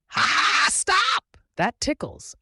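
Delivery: very high, loud ticklish squeal; laughing shriek. A narrator's reading. Broccoli monster gets tickled, high laughing shriek. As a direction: shriek through acted laughter, helplessly tickled. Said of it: "the broccoli monster laughed".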